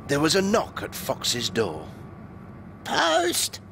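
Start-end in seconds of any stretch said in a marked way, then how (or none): 2.8-3.6 high little squeaky postman call. A narrator's reading. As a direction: squeak it out like a tiny creature at the door. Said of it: "called a squeaky voice".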